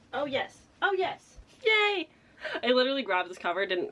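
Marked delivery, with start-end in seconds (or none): 0.1-2.1 high delighted yay; excited cheer. Real, not acted. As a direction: cheer at the lucky find. Finds bug on cover.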